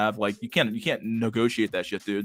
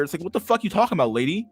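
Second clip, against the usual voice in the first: exasperated rant; 8 semitones higher, 4 dB louder than usual. Real, not acted. His exasperated reply to her.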